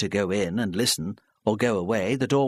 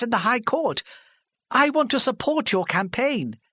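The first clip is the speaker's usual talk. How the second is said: put-on higher, pleading older woman, halting; flustered older lady; 10 semitones higher than usual. Mrs Parry pleading with the hostile crowd, flustered. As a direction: stammer it out, desperate to be heard.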